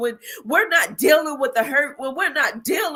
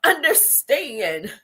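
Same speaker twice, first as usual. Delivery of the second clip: usual speaking voice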